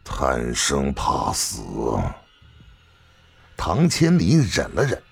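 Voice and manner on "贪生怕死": short scornful chuckle, then slow low contempt; cold, drawn-out disdain; cold sneer. A narrator's reading. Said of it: "关新月冷笑着说道".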